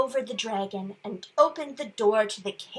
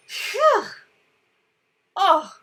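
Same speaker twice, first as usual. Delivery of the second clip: plain reading voice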